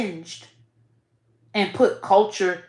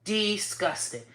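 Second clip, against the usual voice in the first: much softer and slow, trailing off in disgust; soft disgusted repeat; 4 semitones lower, 10 dB quieter than usual. Real, not acted. Vlogger echoes her own verdict, soft and slow. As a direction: let it fall away almost under the breath. Repeats word, drops to murmur.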